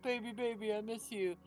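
mock lovey-dovey coaxing; imitated sweet-talk. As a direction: imitate a boyfriend sweet-talking her back. Imitates boyfriend sweet-talking her.

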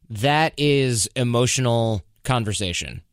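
normal talking voice

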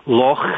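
loch with a throaty Scottish CH. Shown as a demonstration. Explaining the Scottish CH sound to the host, rasped loch. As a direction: end loch on a rasped back-of-the-throat fricative. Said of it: "we say loch in Scotland".

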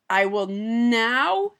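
slow halting speech, balancing. Pretend. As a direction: drag each word out carefully. Pretend balancing on tightrope.

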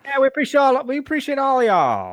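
high, loud mock New York accent; bad New York accent. Co-host thanking all the listeners, in a bad New York accent. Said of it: "another bad New York accent".

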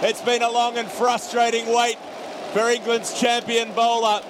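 cricket commentator. Real, not acted. Live call of a wicket.

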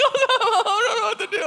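high-pitched imitation of a wailing child; mock crying. Daughter losing it over the mouse, mock crying. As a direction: blubber it in an exaggerated little-girl falsetto. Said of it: "My daughter is literally crying".

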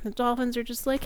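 ordinary delivery

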